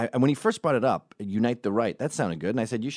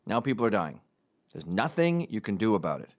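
normal talking voice

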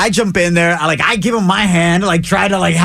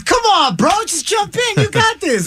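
cheering on. Retold or imitated. Friends egging him to jump.

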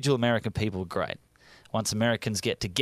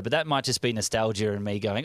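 usual speaking voice

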